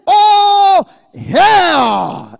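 high, drawn-out triumphant shout; shouted hail. Acted. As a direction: cry the greeting at full force, then let it fall. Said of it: "You need to shout".

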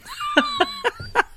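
very high burst of laughter; real squealing cackle; shrieking laugh. Real, not acted. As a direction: shriek with delight. Laughing at nose shit.

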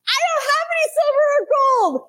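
high, loud, giddy delight; almost squeal it with glee. Retold or imitated. Peter telling the beggar he has no money, high giddy glee. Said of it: "can you hear the giddiness in his voice".

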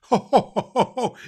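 big booming ho ho ho, high and loud; jolly Santa laugh. Acted. Santa laughing at a child's letter, loud jolly ho ho ho. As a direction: belly laugh of a delighted Santa.

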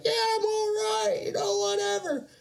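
high falsetto fake-cheerful; squeaky forced politeness to brush it off; mock-nice high voice. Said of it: "trying to be as nice as I can".

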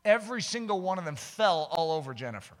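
ordinary delivery

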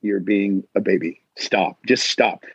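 low, firm self-scolding; stern low voice in your head; inner voice of reason. Acted. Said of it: "have that voice in your head that's like".

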